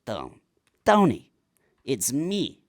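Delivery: hammed-up Italian-American mobster accent. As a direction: call out to Tony in an Italian accent. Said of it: "I did just wanna do an Italian accent".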